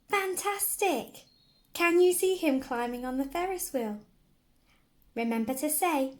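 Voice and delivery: excited praise. Acted. Praising child finding Andy.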